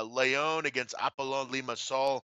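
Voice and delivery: usual speaking voice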